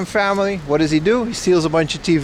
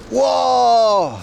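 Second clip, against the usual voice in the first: surprised whoa; 5 semitones higher than usual. Real, not acted. Reacting to a viewer question.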